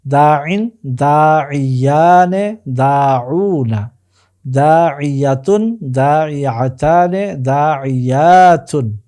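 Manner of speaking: slow chanted list from দাইন through দাইয়াতুন; pronunciation drill. Shown as a demonstration. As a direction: recite দাইন to দাইয়াতুন slowly, long vowels stretched. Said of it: "উচ্চারণগুলো".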